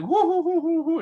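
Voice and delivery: high, loud hooting giggle; mock tickled woo-hoo laugh; imitating her laugh. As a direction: hoot it out like a delighted old lady. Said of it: "and she's laughing".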